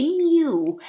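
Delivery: high, drawn-out meow; cat's mew. Acted. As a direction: mew like a cat. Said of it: "a cat's voice say".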